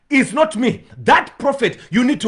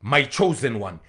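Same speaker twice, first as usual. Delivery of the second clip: lower, softer divine voice from the cloud; hushed, lowered voice of God naming his son; 9 semitones lower, 7 dB quieter than usual. Acted. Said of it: "And the voice came".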